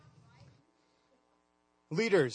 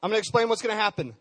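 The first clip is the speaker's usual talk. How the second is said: normal talking voice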